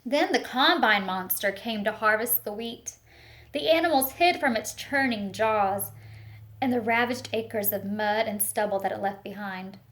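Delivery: put-on expressive picture-book reading for children; storytelling read-aloud. Teacher reading a picture book to the class, storytelling voice. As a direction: paint the story for a class of little ones.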